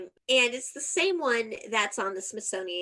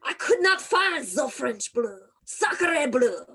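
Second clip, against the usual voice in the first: mock French accent, louder, for Napoleon; 5 dB louder, slower than usual. Host plays Napoleon admitting the lost diamond, mock French accent. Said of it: "I'm living for the accent right now".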